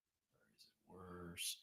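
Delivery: slow breathy whisper; whispered test. Shown as a demonstration. Streamer testing the mic by whispering slowly. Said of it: "when I talk quietly".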